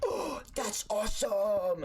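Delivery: high, drawn-out awestruck cheer; excited exclamation. Retold or imitated. Kid to brother during their little moment, excited. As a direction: stretch it out, high and thrilled. Acted excited quote in story.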